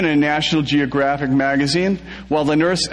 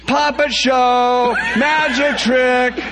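screaming; 8 semitones higher, much slower than usual. A narrator's reading. Brit smacking the Romeo, screaming high and slow. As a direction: shriek each word out long and high. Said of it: "And Brittany screams".

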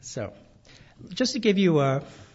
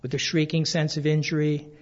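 measured, slightly louder reading aloud of a painful memory; memoir reading; 5 dB louder than usual. Acted. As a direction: read the passage steadily, letting the weight land. Reads from his memoir.

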